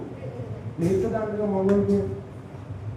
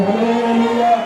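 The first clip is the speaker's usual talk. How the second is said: loud praise; 5 dB louder, slower than usual. Shown as a demonstration. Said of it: "నోరెత్తి స్తోత్రం".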